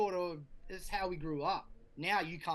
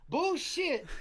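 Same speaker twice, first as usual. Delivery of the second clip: much higher, louder scoffing; indignant retort; 12 semitones higher, 5 dB louder than usual. Retold or imitated. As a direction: fire it back at the wife in high disbelief. Retorting to his wife.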